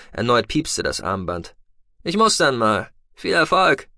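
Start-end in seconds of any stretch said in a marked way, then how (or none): 2.0-3.9 a lightly raised, brisk voice for the pilot Tech; Tech's voice. A narrator's reading. Tech telling the captive noble they are leaving, lightly voiced. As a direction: voice Tech a touch higher and brighter than the narrator.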